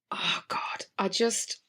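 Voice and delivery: soft unvoiced groan of despair; a breathless oh God under the breath; exasperated whisper. Real, not acted. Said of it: "exasperated aside".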